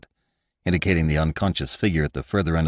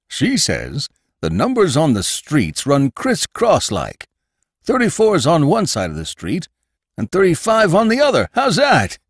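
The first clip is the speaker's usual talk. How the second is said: higher, louder gossipy landlord voice; Jacobus, landlord; 10 semitones higher, 4 dB louder than usual. Acted. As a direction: play the nosy old landlord, pitched up.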